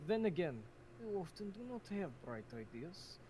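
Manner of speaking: put-on slow, dry Russian accent. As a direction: tease in a deadpan Russian accent. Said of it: "the Russian accent is on point".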